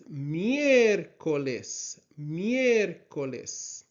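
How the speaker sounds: "miércoles" with a long, leaning stress on "ér"; slow teaching "miércoles", weight on MIÉR; stress on first E. Shown as a demonstration. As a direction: stretch and emphasize the é in "miércoles". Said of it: "just an emphasis on that letter".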